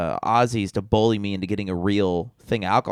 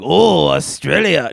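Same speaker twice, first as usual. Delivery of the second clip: mock Australian; 5 semitones higher, 6 dB louder, slower than usual. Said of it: "so he's just like".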